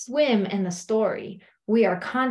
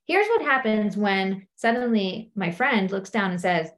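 ordinary talk

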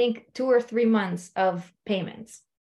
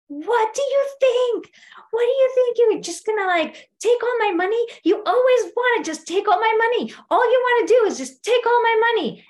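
husband yelling about money, high and loud; imitating a furious husband yelling; 12 semitones higher, 5 dB louder than usual. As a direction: shout it like the outraged husband accusing her. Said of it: "he starts yelling, like, really yelling".

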